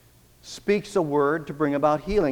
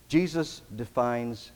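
ordinary talk, nothing marked

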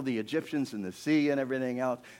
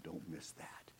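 voiceless whisper for emphasis; hushed aside; 8 semitones lower, 18 dB quieter, slower than usual. Real, not acted. Preacher to congregation after the miracle, hushed. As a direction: drop right down to a whisper.

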